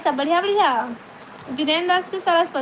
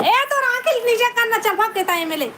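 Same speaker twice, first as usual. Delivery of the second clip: high-pitched sudden scolding; 7 semitones higher, faster than usual. Real, not acted. Shooing a child away mid-stream. (ऊँची आवाज़ में डाँटते हुए)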